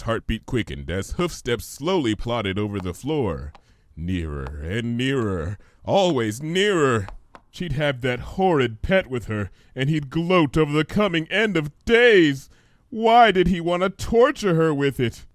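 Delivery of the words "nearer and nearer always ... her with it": rising tension. A narrator's reading. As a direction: build the suspense. Tense narration.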